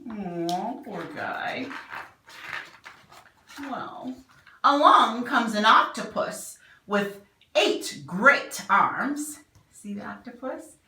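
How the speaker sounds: ordinary delivery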